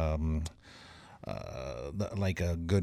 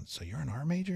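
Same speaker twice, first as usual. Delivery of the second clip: tattoo artist; 8 semitones higher, 5 dB quieter than usual. Retold or imitated. Tattoo artist voiced in story.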